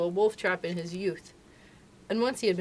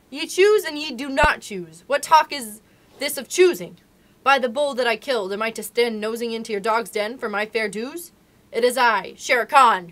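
roaring tiger; 3 semitones higher, 8 dB louder than usual. Acted. Tiger wedged in the cave mouth roaring at the wolves, furious. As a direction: bellow it like a tiger blocked at a cave.

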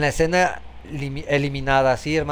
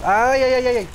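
high, loud panicky yelps; startled cries; 10 semitones higher, 6 dB louder than usual. Real, not acted. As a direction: yelp in sudden alarm. Enemigo aparece de golpe. (gritos de susto)

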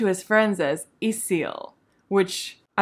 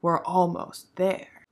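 slow mysterious Elendil; much slower than usual. Retold or imitated. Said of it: "And Elendil is like".

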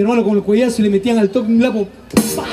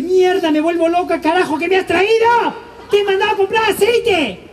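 higher, fed-up mother scolding; mock mom losing it over the wrong shopping; exasperated mom; 6 semitones higher than usual. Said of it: "lo ve venir y dice". (mamá exasperada regañando)

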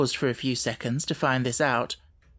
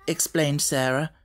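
plain reading voice

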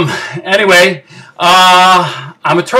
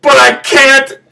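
high, breaking into sobs; despairing weepy outburst; tearful wail; 5 semitones higher than usual. Acted. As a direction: give up in a squeaky, hammed-up cry. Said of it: "I promised myself I wouldn't cry".

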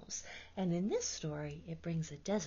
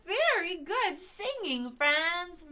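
much higher, louder cheerful praise; bright praise; 12 semitones higher, 8 dB louder, slower than usual. Real, not acted. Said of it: "praising children after song".

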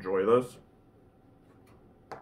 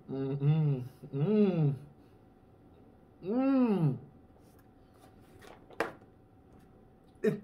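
humming while chewing; 9 semitones higher, slower than usual. Real, not acted. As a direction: mouth full of food. Seen 0: Took spoonful at -5; lips closed, jaw working through hums.

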